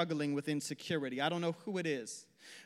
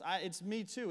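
usual speaking voice

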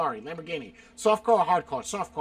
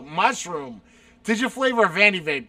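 ordinary talk, nothing marked

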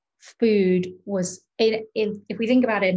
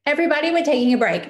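louder, higher, fast announcement; calling out to the room; 7 semitones higher, 6 dB louder, much faster than usual. Retold or imitated. The friend telling the whole class they're taking a break, called out quickly. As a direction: raise your voice to call a break.

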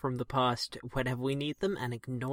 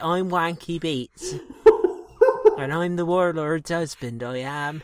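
put-on loud, proud comic Bristol accent; broad Bristol accent; 3 semitones higher, 9 dB louder than usual. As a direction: lean hard into the Bristol accent. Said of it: "I'm dropping the accent".

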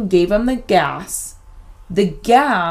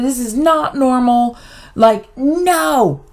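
frantic refusal; 6 semitones higher, slower than usual. Retold or imitated. Mom refuses the restraint.

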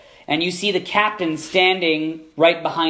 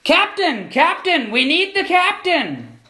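lookout's yell; 10 semitones higher, slower than usual. Acted. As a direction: bellow down from the crow's nest for the captain. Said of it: "you hear a yell from the crow's nest".

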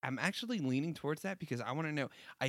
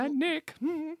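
high, saucy purr; 7 dB louder, slower than usual. Acted. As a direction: tease it, suggestive and high. Saucy remark about Nick.

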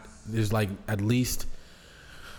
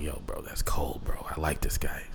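slow, hushed whisper, lower and much softer; awed whisper; 7 semitones lower, 5 dB quieter than usual. Retold or imitated. A man whispering his admiration for a bold politician. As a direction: breathe it out like you can't believe he said that.

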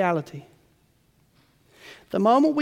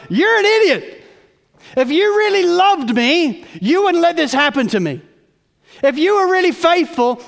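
much higher and louder, railing at God; angry accusation; 9 semitones higher, 9 dB louder than usual. Acted. A sick believer railing at God, angry and loud. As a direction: hurl the blame upward. Sufferer blaming God in anger.

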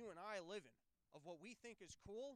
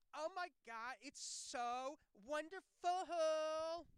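much higher, louder, very slow swoon; mock gushing celebrity; 9 semitones higher, 11 dB louder, much slower than usual. Host imagining celebrities waking up with a slow high gush. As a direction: swoon over every word like an airhead. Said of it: "before they can start going".

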